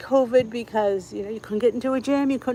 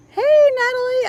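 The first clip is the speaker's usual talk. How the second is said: excited greeting; 8 dB louder than usual. Real, not acted. Answering Natalie's compliment on the livestream, excited. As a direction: burst in high and loud.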